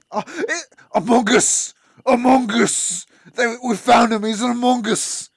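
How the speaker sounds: loud, high excited yelling; excited shout. Real, not acted. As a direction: shout it out in delight. Spots an Among Us figure.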